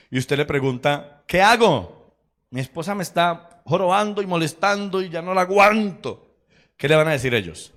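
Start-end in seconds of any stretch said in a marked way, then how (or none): 1.3-6.4 high, loud acted complaint; exasperated husband. Husband grumbles to divorced friends about his wife, exasperated. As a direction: complain at the end of your rope, pitched up.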